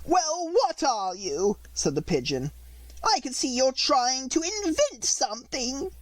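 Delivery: very high, very slow bird voice; fretful pigeon. A narrator's reading. Pigeon grumbles past Alice, high and slow. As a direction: drag out the complaint like a fussy pigeon.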